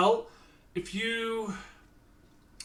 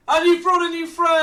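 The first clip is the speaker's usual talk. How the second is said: amused; 10 semitones higher, 7 dB louder than usual. Acted. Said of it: "comes and finds you and says".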